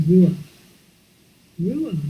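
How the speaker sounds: ruah said slowly with a breathy open ah; ruah, Hebrew for sacred wind. Shown as a demonstration. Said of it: "It's a Hebrew word".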